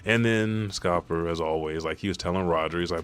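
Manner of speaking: ordinary talk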